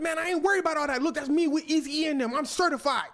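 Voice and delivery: loud, high-pitched bragging; defensive bluster. Acted. Michael boasting of his connections, loudly defensive. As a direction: protest too much, full volume. Boaster insists he is not worried.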